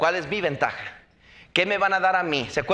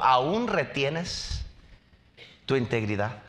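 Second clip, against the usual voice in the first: slow mocking question; 6 semitones lower, slower than usual. Quoting Job's wife.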